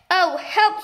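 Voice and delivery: panicked scream. A narrator's reading. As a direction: cry out for help. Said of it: "screamed Missus Twit".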